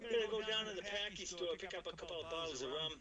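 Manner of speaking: put-on quick Boston accent. A Boston local heading to the Packy store, in a quick Boston accent. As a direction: rattle it off like a Revere local. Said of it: "Boston accent".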